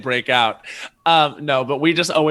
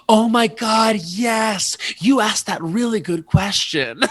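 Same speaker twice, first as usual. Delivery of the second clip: high, loud, drawn-out gushing; excited recognition; 7 semitones higher, 5 dB louder, slower than usual. Retold or imitated. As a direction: shout it in delighted recognition. Acts out friend's excited recognition.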